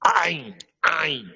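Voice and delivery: "Ain" made in the middle of the throat. Shown as a demonstration. Teacher demonstrating the letter to learners, throaty "Ain". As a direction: squeeze each "Ain" from the throat. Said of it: "du milieu de la gorge".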